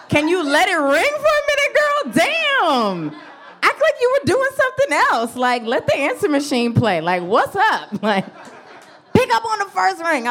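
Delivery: high-pitched, hammed-up scolding; mock exasperation. Comedian chiding the girl for picking up at once, mock exasperated. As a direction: pitch jumping up in mock indignation.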